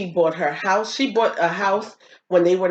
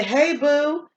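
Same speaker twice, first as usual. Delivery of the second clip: a mock smooth "hey boo", pitched up; imitating grandpa; 7 semitones higher than usual. Granddaughter retelling grandpa's flirty greeting, lightly voiced. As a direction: playfully voice the old man's greeting.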